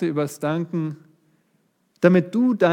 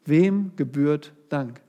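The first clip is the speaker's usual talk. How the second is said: slow, weighty "wem gebührt Dank?" with stress on "wem"; slow question; slower than usual. Shown as a demonstration. Preacher posing the first point to the congregation, slow and weighty. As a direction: lay out "wem gebührt Dank?" slowly, leaning on "wem". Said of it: "poses the question twice".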